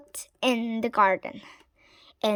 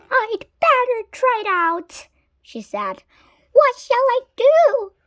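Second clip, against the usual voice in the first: high scheming witch; 9 semitones higher than usual. A narrator's reading. Winnie deciding to test the computer, playful. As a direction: toy with the idea like a witch.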